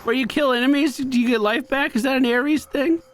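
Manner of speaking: usual speaking voice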